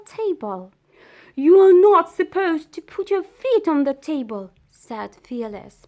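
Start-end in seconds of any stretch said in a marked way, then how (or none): 1.4-4.5 indignant dog. A narrator's reading. Dog scolding burglar on table.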